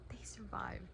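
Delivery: a soft, hushed whisper; whispered relief. Real, not acted. Telling viewers the characters survived, whispering in relief. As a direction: whisper it in awe.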